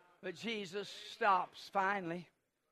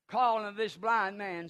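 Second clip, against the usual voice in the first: ordinary talk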